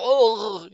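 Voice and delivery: strangled high choking grunt; choking ugh. Acted. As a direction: choke out a startled ugh. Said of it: "A few choking sounds".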